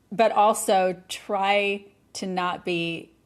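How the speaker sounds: ordinary talk, nothing marked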